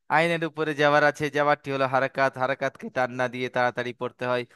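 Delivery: fast, run-together rote recitation; quick recap. Shown as a demonstration. Teacher repeating what he just said to students, rapid recap. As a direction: rattle the rule off fast.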